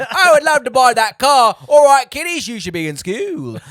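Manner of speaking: booming, high hammed-up Cockney; Cockney accent. Junkyard man scolding truant kiddies, loud Cockney accent. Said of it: "Go Cockney, go Cockney".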